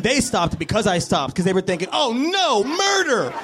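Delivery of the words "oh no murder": high, drawn-out cry of horror; hammed-up panic, pitched way up; mock horrified cry. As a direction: wail it in mock terror. Onlookers' imagined horrified cry.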